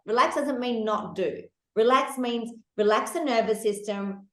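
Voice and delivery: ordinary talk, nothing marked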